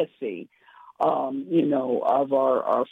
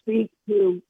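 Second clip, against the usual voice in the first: normal talking voice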